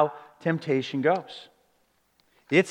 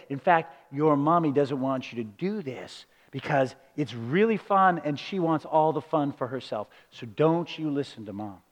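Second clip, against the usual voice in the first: a lightly voiced, softer, lower sly stranger; 4 semitones lower, 5 dB quieter than usual. Preacher voices a stranger luring a child, softly sly. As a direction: coax the child quietly, like a tempter. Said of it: "And then imagine that he said".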